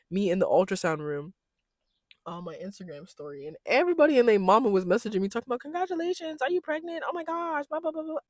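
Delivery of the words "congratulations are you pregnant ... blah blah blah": high-pitched mimicry of excited well-wishers; mock gushing followers. Followers messaging congratulations on a pregnancy, high mocking imitation.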